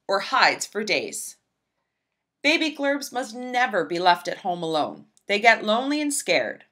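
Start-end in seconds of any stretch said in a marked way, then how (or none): none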